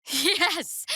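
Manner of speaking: high laughing cheer of triumph; high gleeful laugh-cheer; laughing yes. Acted. Triumphant outburst.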